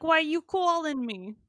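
loud, high New York snarl; brash New Yorker annoyed at a caller; New York tough guy. Pretend. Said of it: "I was like".

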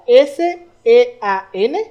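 S E A N spelled letter by letter, very slow; spelled out slowly. Shown as a demonstration. Friend spelling the Irish name for the podcast, spelled out slowly. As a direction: spell S E A N out to the listener, one letter at a time. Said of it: "como Sin, Sean".

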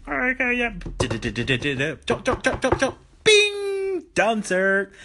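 high timid cook voice; eager cook reporting back; meek kitchen cook. Acted. Cook finishing salad for chef Arnold, high eager voice.

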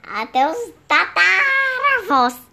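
giggling through the words; laughing. Real, not acted. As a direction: laugh while correcting yourself. Ri enquanto repete.

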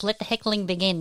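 usual speaking voice

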